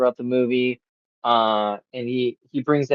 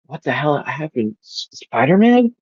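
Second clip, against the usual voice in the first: bewildered; 3 semitones higher than usual. Retold or imitated. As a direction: imitate someone waking up baffled. Villain wakes up confused.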